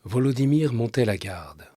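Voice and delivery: measured literary reading with long pauses; subdued radio storyteller, letting each sentence settle; story narration. A narrator's reading. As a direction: read the story in a calm, restrained voice. Reads a short story aloud.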